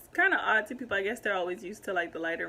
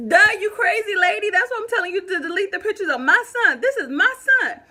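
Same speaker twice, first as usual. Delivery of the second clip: much higher, loud, angry rant; loud exasperation; 11 semitones higher, 11 dB louder, faster than usual. Real, not acted. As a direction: blow up at her, loud and high. Venting at the woman, still heated.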